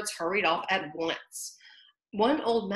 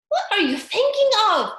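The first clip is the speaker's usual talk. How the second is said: severe mouse; 14 semitones higher, 10 dB louder than usual. A narrator's reading. Mouse scolding Alice, severe squeaky voice.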